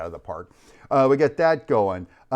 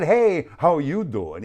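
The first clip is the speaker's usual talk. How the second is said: higher, slow, put-on hello; awkward greeting; 5 semitones higher, 4 dB louder, slower than usual. Retelling his hello on a job site, playfully awkward. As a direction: exaggerate an awkward, drawn-out greeting. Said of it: "awkward kind of way".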